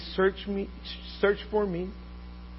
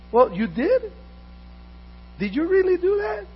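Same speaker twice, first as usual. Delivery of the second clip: mock surprise; 7 dB louder than usual. Mock surprised excuse in scene.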